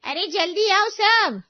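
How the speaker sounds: loud, slow, high alarmed shout calling the neighbours; shouting for help. A narrator's reading. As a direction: yell it out to the whole street, stretched and urgent. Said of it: "चिल्लाना शुरू करती है". (ज़ोर से चिल्लाकर सबको बुलाना)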